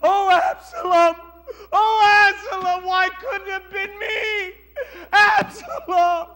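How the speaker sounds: high, loud, slow wail of grief; a father keening his dead son's name; wailing cry. Acted. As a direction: cry out for a lost son. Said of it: "he begins to cry".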